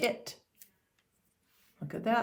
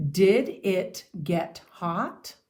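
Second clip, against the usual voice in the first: "Did it get hot?" very slow, rising on "Did" and up at the end; question tune; 5 dB louder, much slower than usual. Shown as a demonstration. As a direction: ask "Did it get hot?" slowly, each word clear, ending on a rise. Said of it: "It's actually a question".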